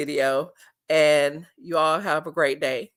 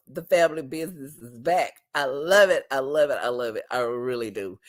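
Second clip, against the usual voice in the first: ordinary talk, nothing marked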